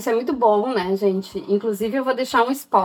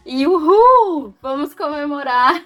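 comemoração rindo; 6 semitones higher, 4 dB louder, slower than usual. Acted. Comemoração irônica do dia nove.

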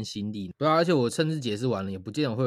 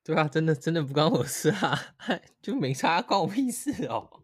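laughing mock indifference; 5 semitones higher than usual. Friend jokingly echoes her dismissive attitude.

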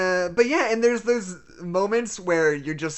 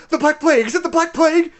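much higher, much louder alarmed cry; mock panicked outburst, high and loud; Meryl Streep's line reading; 12 semitones higher, 9 dB louder, faster than usual. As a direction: shriek the question in sudden horror. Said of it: "her line reading on".

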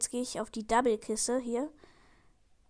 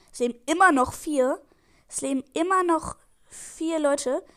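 loud disbelief; 3 semitones higher, 7 dB louder than usual. Real, not acted. Surprised several players still alive.

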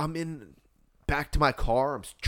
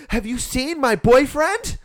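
high, loud, slow mock question; frantic girlfriend; 13 semitones higher, 8 dB louder, slower than usual. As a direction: imitate a woman desperately seeking her boyfriend. Acts out frantic girlfriend asking.

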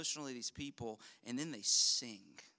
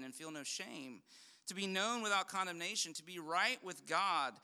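raised, ringing pulpit voice; full-force preaching; 6 semitones higher, 4 dB louder than usual. Acted. Pastor pressing his congregation on the gospel, fervent preaching. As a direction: preach it out to the back row.

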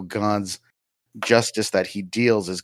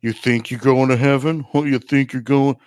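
put-on taunting voice, pitched higher; a hammed-up, higher character voice; keep 'em comfortable voice; 4 semitones higher than usual. Mocking believers who expect heaven, in a put-on higher voice. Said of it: "I don't know why I was doing the".

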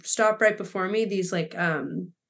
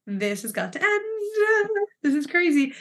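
much higher and slow, mock despair; exasperated self-talk; 8 semitones higher than usual. Quoting her own inner voice while crying, high and drawn out. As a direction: stretch it out, fed up with yourself. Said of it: "And I'm like".

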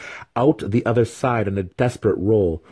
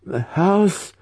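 high, rising spirit question; pondering spirit; 12 semitones higher than usual. A narrator's reading. Spirit trying to recall the house, pondering. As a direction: wonder aloud in a high ghostly voice. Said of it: "Jennifer pondered".

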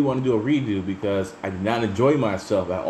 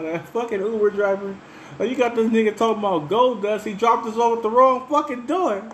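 high, mock furious outburst; mock angry rant; 14 semitones higher than usual. Retelling how he ranted at the Uber driver, hammed-up anger. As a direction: replay the fury at the driver. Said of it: "I was so mad".

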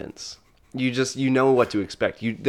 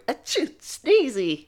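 very high, slow squeaky voice; tiny high-pitched rodent; sneezing little rat; 13 semitones higher, slower than usual. Acted. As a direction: squeak a pretend sneeze into the name. Sneezy gives his name.

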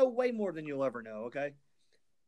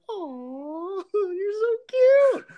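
put-on much higher baby-talk coo with a laugh; cooing at a puppy; 9 dB louder, much slower than usual. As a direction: melt over a tiny puppy.

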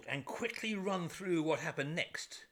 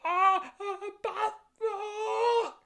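high, drawn-out shocked cry; 13 semitones higher, 8 dB louder, much slower than usual. Retold or imitated. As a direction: gasp out the name in terror. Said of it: "cried out".

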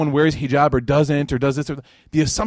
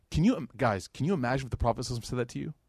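normal talking voice

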